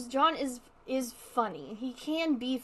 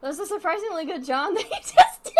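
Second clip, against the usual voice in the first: laughing; 5 semitones higher, 7 dB louder than usual. Real, not acted. Cracking up at her own impression.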